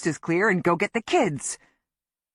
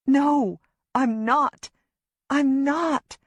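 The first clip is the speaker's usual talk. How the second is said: higher, pressing denial; frantic denial; 7 semitones higher, much slower than usual. Acted. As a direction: insist in a panic. Denying Griff's accusation.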